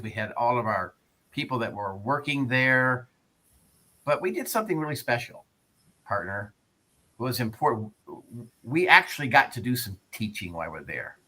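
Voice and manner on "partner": Texan drawl on partner; Texan accent. Real, not acted. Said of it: "Your Texan accent, partner".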